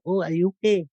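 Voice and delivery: lightly high, soft mock-dainty tone; a hint of sweet daintiness as he wakes his wife; mock delicate voice. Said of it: "in a delicate voice".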